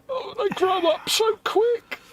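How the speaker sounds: high, mock teary parent. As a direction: squeak it like a proud weepy dad. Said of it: "Si's at home welling up".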